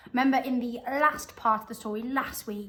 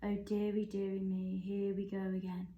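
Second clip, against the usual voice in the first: murmuring softly; 2 semitones lower, 6 dB quieter than usual. A narrator's reading. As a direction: mutter it quietly to yourself. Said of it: "murmuring softly under his breath".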